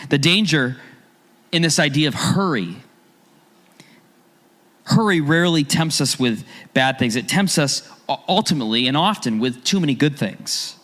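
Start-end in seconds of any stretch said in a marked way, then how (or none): none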